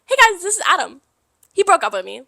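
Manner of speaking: high, loud announcement; loud, high-pitched introduction to a crowd; party announcement. Retold or imitated. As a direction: announce it to the whole room.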